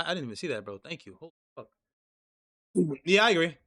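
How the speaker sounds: ordinary talk, nothing marked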